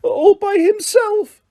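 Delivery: very high, slow whimpering squeak; high whimpering house-elf voice. A narrator's reading. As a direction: whimper it like a drunk, lost elf. Drunk Winky pleads.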